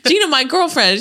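high, fast laughing character voice; hammed-up movie character; mock timid reply. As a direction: squeak it out nervously, laughing. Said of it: "She's like".

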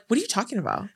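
lightly mimicked innocent question, dropping away softly; imitated feigned confusion. Sister caught in her excuse, mock confusion. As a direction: play dumb, letting the end fall quiet. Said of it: "the way that she was like".

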